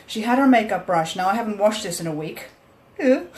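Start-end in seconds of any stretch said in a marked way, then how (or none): 3.0-3.3 high squeal of disgust. Real, not acted. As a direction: recoil at the dirty brush. Unwashed brush shown.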